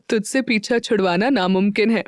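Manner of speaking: ordinary delivery, nothing marked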